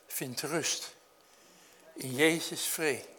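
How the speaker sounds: slow, measured hymn recital; recited hymn. Acted. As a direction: speak the verse like a remembered song. Recites children's hymn lyrics.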